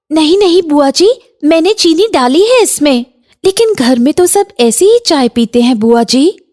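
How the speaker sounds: put-on high-pitched, polite young bahu; protesting daughter-in-law. Bahu defending her tea to aunt, high polite protest.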